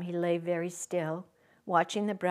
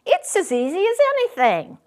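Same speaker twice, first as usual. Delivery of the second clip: high, bright-voiced boast; boastful furry rabbit; 11 semitones higher, 8 dB louder, faster than usual. A narrator's reading. Furry rabbit boasting to the little rabbit, high and cocky. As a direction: show off how easy it is. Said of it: "said the furry rabbit".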